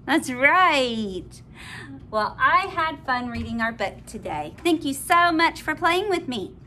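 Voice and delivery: ordinary delivery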